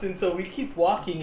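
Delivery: ordinary talk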